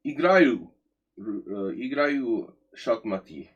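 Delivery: put-on slow, clumsy Russian accent, first word high and loud; bad Russian accent. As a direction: try your rusty Russian accent. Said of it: "What a horrible Russian accent".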